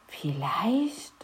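soft whispered Vielleicht; whispering to his sister. A narrator's reading. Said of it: "flüsterte Matz seiner Schwester zu". (geflüstert)

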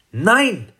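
put-on high, defiant child's no; trotzig. A narrator's reading. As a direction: refuse like a stubborn little girl. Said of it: "sagte Mathilda trotzig". (hohes, trotziges Kinder-Nein)

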